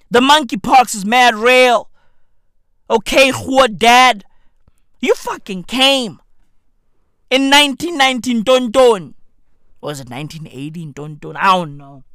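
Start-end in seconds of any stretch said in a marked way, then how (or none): none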